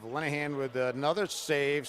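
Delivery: building play-by-play call; rising excitement. Real, not acted. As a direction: call the second save with a lift. Second save, rising excitement.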